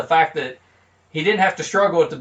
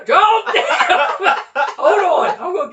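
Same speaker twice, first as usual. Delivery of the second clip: laughing; 12 semitones higher, 5 dB louder, slower than usual. Real, not acted. Flubs again, laughing.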